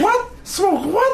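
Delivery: very high squeaky voice; squeaky Doctor Tran quote. Retold or imitated. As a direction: squeal it in disbelief. Quoting Doctor Tran line.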